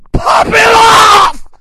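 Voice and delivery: very high, slow, screamed; hype shout. Acted. Host hyping the remix release, slow high scream. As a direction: scream it out like a party starting.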